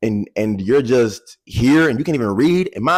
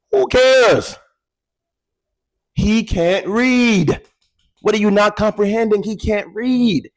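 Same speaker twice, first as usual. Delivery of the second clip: incredulous; 9 semitones higher than usual. Retold or imitated. Classmates' retort to the teacher.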